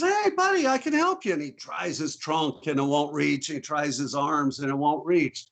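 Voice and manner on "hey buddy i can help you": elephant reassuring. Acted. Elephant reassures mouse in joke.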